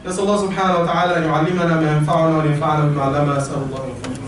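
low, rapid formulaic Arabic supplication; Arabic prayer. Real, not acted. Preacher closing the first sermon, low rapid prayer.

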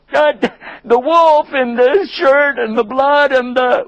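slow, high, exasperated list; slow exasperated rant; exasperated father. Acted. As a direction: spell out their nonsense in disbelief. Father dismisses their excuse.

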